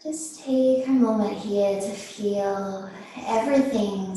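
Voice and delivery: slow, unhurried yoga teacher voice with long pauses; guided meditation. Real, not acted. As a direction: ease them gently into stillness, phrase by phrase. Leads seated breathing meditation.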